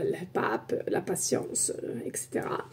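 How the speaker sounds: breathy whispered aside; whispering. Real, not acted. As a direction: drop to a whisper. Trailing private aside.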